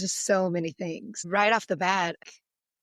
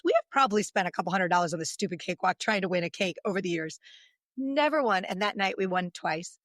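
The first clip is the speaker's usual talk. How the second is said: normal talking voice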